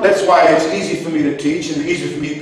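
usual speaking voice

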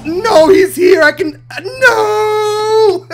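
high loud panicked yell breaking into a cry; panicked shout. Real, not acted. Gamer panics as the dragon catches him, yelling high. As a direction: scream as the monster reaches you.

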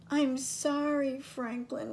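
put-on high small child voice, pleading; a tiny sister saying sorry; little sister voice. A narrator's reading. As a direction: Harriet apologizes to her brother in a small high voice. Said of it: "whispered Harriet".

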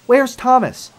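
loud, high-pitched whine of protest; fuming complaint. Acted. Percy, worn out waiting for Thomas, complains fuming. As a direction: complain loudly, fed up and high. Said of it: "he complained".

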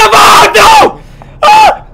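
very high, loud shriek; real fright, loud and high; terrified scream. Real, not acted. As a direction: scream as the thing appears. Jumpscare in game.

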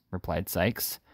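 ordinary delivery, nothing marked

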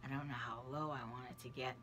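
ordinary talk

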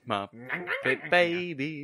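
stretched out, lilting; slow sing-song title. Acted. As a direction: drawl the title like a jingle. Drawn-out echo of title.

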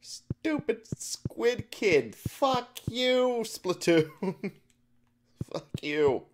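high, drawn-out frustrated whine; real high-pitched exasperation; whiny cursing. Real, not acted. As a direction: curse it slowly in a high, aggrieved voice. Cursing the game after loss.

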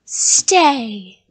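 loud, falling monster order; commanded. A narrator's reading. Creature to the fleeing child, loud command. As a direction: boom out the order. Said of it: "the creature commanded".

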